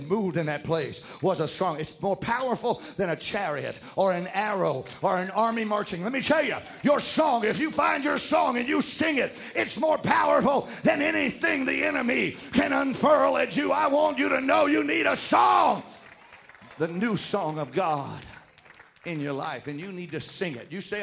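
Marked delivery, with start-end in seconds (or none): 6.0-15.8 raised, forceful preacher voice; full-force preaching. Real, not acted. Preacher urging congregation to find their song, full-force preaching. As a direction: build to a shouted exhortation.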